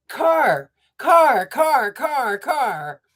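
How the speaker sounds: high, loud first word; excited toddler. Retold or imitated. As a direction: squeal it like a baby. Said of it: "And I said".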